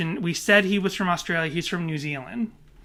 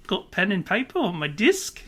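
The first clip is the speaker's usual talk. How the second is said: bad Australian accent; 2 semitones higher than usual. Shown as a demonstration. Podcaster practises desk phrases, mock Australian accent. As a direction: exaggerate the Australian vowels. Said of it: "gear up for my bad Australian accent".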